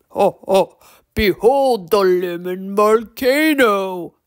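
loud, slow gravelly troll showman; booming, drawn-out creature announcement; grand troll declaration. A narrator's reading. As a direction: proclaim it like a troll magician unveiling a wonder. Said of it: "Torque declared".

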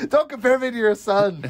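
high-pitched, laughing indignant objection; mock protest. Joseph answering Mary's comparison to Jesus, mock-indignant. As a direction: protest like a wronged husband, amused.

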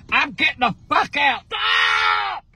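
fast, frantic shouts to stop; shouting. Real, not acted. Grandma yelling at car thief.

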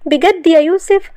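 high, loud, slow rising question; light up with disbelieving joy, pitch soaring; delighted. A narrator's reading. Shahd learning her family will visit, delighted. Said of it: "ومن فرحتها حضنته".

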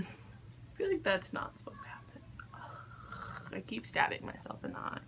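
frustrated whisper. Real, not acted. Frustrated mutter while applying.

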